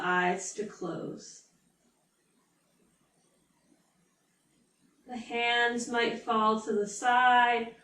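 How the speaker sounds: unhurried yoga nidra guide, leaving space after each phrase; guided meditation. Real, not acted. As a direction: ease them into stillness, drawing out key words.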